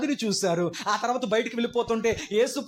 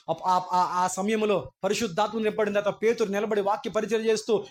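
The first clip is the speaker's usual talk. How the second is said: ordinary talk, nothing marked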